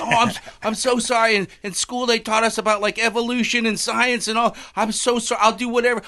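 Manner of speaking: high, hurried mock begging; hammed-up pleading. Man begging God to save him from a bear, hammed-up pleading. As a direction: beg for your life, played for laughs.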